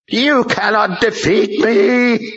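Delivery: high, slow, strained demon scream. A narrator's reading. Possessed strong man screams at the general, slow demon scream. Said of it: "he screamed".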